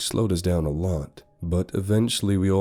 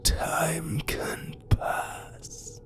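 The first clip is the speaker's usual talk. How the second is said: slow, eerie, menacing whisper; sinister spirit voice; 4 semitones higher, much slower than usual. Acted. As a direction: drag out each threat slowly. Villain's long threat.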